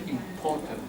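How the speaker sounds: "Important" softly, with an open POR; quiet im-POR-tant for "Important"; corrected "important". Shown as a demonstration. Teacher repeats the word for daily use, "Important" softly with POR. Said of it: "then we say".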